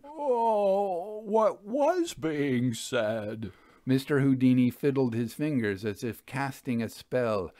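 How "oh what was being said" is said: put-on high, slow childish whine; a grown medium whining like a scolded kid; whining teased child. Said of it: "Sounding like a teased child, he whined".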